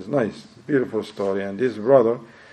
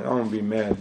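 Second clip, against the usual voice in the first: ordinary talk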